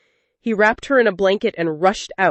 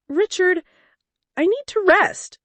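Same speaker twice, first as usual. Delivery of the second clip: Sophia voiced much higher, a weary plea; higher, drained voice asking to stop; tired wife; 12 semitones higher than usual. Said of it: "she said one evening".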